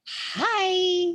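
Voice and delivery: high, loud, drawn-out hi; long high-pitched sociable greeting; little child greeting. Retold or imitated. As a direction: imitate a child calling out hello. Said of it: "you know, she'll say".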